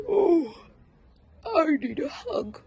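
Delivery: high, loud yearning bear cub; high longing little bear; young bear longing. Acted. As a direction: sigh it out like a cub wanting a hug. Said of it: "said Douglas".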